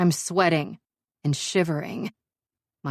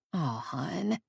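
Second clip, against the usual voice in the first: soft, tender sympathy; 7 dB quieter than usual. Acted. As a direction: hush it gently to a friend. Friend softening towards her.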